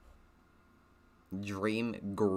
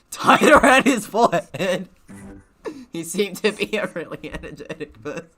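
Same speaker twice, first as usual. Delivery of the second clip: reading through loud real laughter; words broken by giggles, louder; laughing; 10 semitones higher, 13 dB louder than usual. Real, not acted. Laughing at having read bandana as banana.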